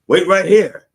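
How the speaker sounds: high, loud mock first lady; first lady stalling. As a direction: snap it out to hold them off. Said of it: "And she'd go".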